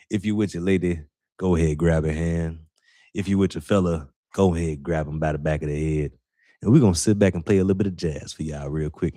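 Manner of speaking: low, smooth late-night radio host. Shown as a demonstration. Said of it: "I'ma give y'all my radio voice".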